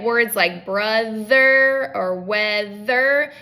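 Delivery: "brother" and "weather" said slowly with a voiced th in the middle; slow careful examples. Shown as a demonstration. Therapist showing tricky middle th words, slow and careful. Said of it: "those take a lot of planning".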